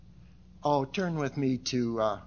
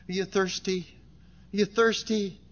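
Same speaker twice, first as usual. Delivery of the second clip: ordinary talk, nothing marked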